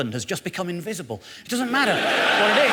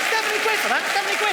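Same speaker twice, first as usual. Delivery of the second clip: incredulous; 12 semitones higher, faster than usual. Real, not acted. Incredulous exclamation.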